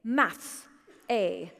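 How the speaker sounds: imitating a British father reading out grades; British accent. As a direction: put on a British dad's accent, each word set apart. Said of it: "allowed to make that accent 'cause he actually is British".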